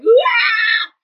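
loud, high imitated crying wail; mock crying wail. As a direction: let out a hammed-up burst of crying. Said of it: "you get into your car and you're like".

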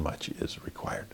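barely breathed, no voice; whispered. Shown as a demonstration. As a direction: whisper it as a solemn close. Repeats line as whispered close.

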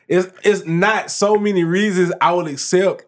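usual speaking voice

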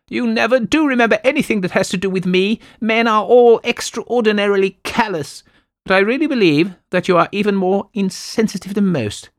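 high, loud complaining wife voice; fretful invalid wife. Acted. Wife scolding her husband George, high and loud. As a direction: whine at George like a fussy invalid.

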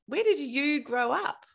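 ordinary talk

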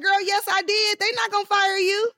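high, sassy retort; acted-out insistence; defiant comeback. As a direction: stand your ground in a high voice. Acts her defiant comeback.

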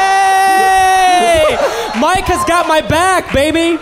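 hammed-up triumphant cheer; playful hype shout. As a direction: crow about your backup to the room. Cheering on a friend's backing.

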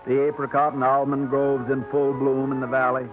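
usual speaking voice

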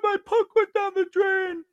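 put-on whiny kid. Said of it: "He's like".

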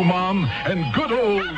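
ordinary talk, nothing marked

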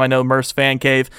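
ordinary talk

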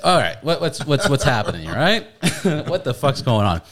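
high, loud, laughing disbelief; laughing alarm at a stranger who knows too much; shocked disbelief. Retold or imitated. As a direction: blurt it out half-laughing in shock.

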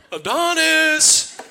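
loud, high, drawn-out call across the yard, laughing; mock calling the dog. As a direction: bellow the dog's name from the back porch, hammed up. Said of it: "call for a dog named Adonis".

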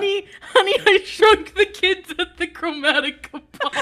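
loud, high comic announcement; mock frantic spouse. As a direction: call out to a spouse in hammed-up alarm. Mock spouse phone call.

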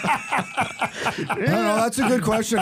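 chuckling through the answer, stretched and slow; laughing. Real, not acted. Answering the host's question while laughing. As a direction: laugh your way into the reply.

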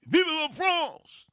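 high, slow French cheer; French accent. Acted. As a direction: belt it out in French.

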